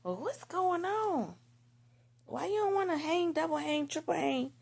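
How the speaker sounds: whiny mock-friend voice; homegirls. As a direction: coax a friend to stay out, pitch way up. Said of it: "my homegirls will be like".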